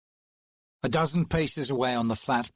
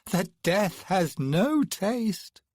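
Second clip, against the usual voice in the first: slow, bored drawl, higher; 8 semitones higher, much slower than usual. Acted. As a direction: stretch the words out lazily. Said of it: "the woman drawled".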